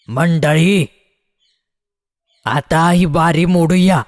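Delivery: narrated call to the assembly, drawn out; village headman's shout. A narrator's reading. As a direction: call out to the crowd with mild force. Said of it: "Chandu Patil नाक फेंदारून ओरडला".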